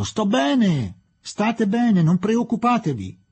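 high, loud reassuring shout; yelled. A narrator's reading. Fielding from the tracks to departing friends, yelling. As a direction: shout after a departing train. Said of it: "urlò dietro di loro".

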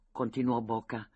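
plain reading voice

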